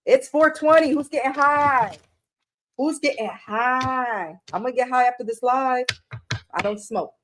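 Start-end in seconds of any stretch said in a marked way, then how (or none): 2.8-4.4 slow drawl. Pretend. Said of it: "repeats the question".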